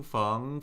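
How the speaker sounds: "feng" rising steadily; second tone. Shown as a demonstration. Teacher cycling four tones on a syllable, "feng" rising. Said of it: "Let's do the four tones".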